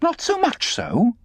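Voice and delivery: very high, slow incredulous question; challenging echo. A narrator's reading. Estella challenges the boy's answer, taunting. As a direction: throw it back, pitched high and rising.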